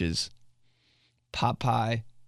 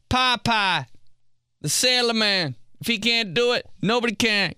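high cartoon Popeye voice; cartoon sailor; 10 semitones higher than usual.

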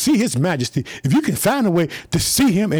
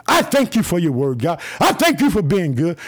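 ordinary talk

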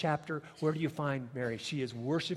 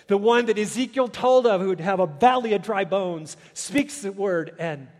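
louder and higher, proclaiming; preaching at full force; 5 semitones higher, 12 dB louder than usual. Acted. Preacher to the congregation, proclaiming Jesus's command. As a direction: let it ring, slowing on let there be life. Preaching of Ezekiel's dry bones.

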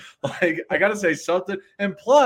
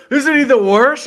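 mocking taunt; 5 semitones higher, 10 dB louder than usual. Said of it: "Fucking Reed's over there like".

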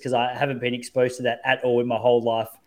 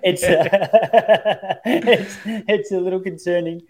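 talking through laughter, pitched up; laughing; 5 semitones higher, 4 dB louder, slower than usual. Real, not acted. Admitting to friends that he slams things upstairs, laughing. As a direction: laugh your way through the admission. Amused confession about own outbursts.

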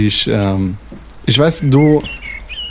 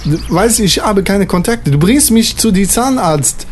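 put-on French accent, raised; 2 semitones higher than usual. Frenchman explaining to Manfred, worried French accent.

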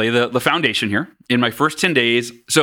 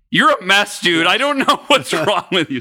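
raised-pitch imitation of a fed-up spouse; mock exasperation; 6 semitones higher than usual. Wife fed up with husband's months of crying, mock exasperation. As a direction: act out exasperation, a laugh slipping in.

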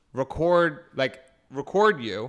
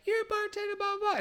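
much higher, squeaky mock voice; mocking customer voice; 3 dB quieter than usual. Bartender mimicking the rude customer, squeaky mockery. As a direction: parody the snooty customer. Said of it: "she was like".

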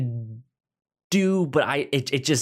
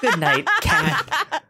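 mock bedtime goodnight; 13 semitones higher, 4 dB louder, slower than usual. Escalating goodnight joke.

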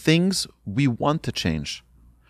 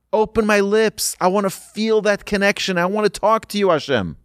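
high, urgent acted prayer; earnest pleading; 7 semitones higher than usual. Worshipper begging Hashem for real connection in prayer, pleading. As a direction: plead upward in a raised pitch. Acted heartfelt plea to God.

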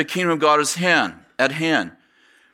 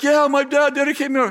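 imitated terrified man; 10 semitones higher than usual. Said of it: "looking like he's terrified".

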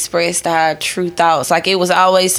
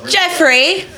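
higher call across the room to Jeffrey; 9 semitones higher than usual. Real, not acted. Calling to Jeffrey across the room to fetch drinks, raised call.

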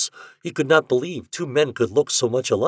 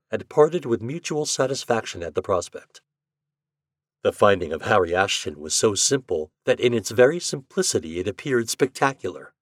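ordinary delivery

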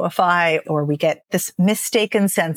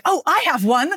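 eager voice; 9 semitones higher than usual. Retold or imitated. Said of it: "everyone's like".

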